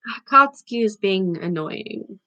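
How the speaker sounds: ordinary delivery, nothing marked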